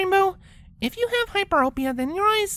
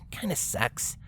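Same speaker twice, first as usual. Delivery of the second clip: muttered; 11 semitones lower, 8 dB quieter than usual. Acted. Rainbow muttering.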